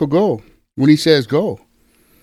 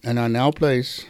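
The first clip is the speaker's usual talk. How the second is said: prayer; 2 semitones lower than usual. Acted. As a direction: lead the prayer gently, phrase by phrase. Leads salvation prayer.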